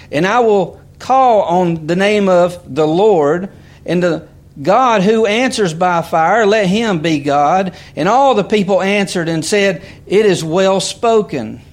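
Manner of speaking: emphatic preacher reading the Bible aloud; scripture reading. Real, not acted. Reads scripture aloud to congregation.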